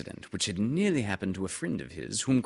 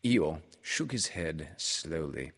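ordinary delivery

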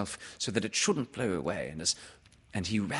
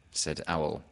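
plain reading voice

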